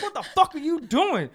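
high-pitched angry demand; pissed off. Retold or imitated. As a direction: re-enact an outraged confrontation. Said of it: "So I'm pissed".

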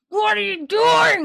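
high, loud imitated shout at the kid; mock angry shout. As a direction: snap at the child like a furious dad. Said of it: "is so mad at this kid".

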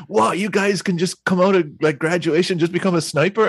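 high-pitched incredulous questioning; imitated astonishment. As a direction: imitate a cop who can't believe it. Cops' astonishment retold.

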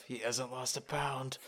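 lightly voiced disappointed father; quiet deadpan dad. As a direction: mutter it in dismay to the wife. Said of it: "and just his eyes of".

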